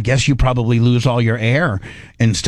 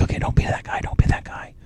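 hushed, breathy self-reassurance; whispering to himself; 6 dB quieter than usual. Retold or imitated. In the MRI tube, whispering to himself to stay calm.

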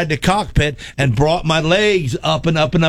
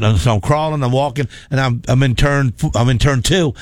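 ordinary talk, nothing marked

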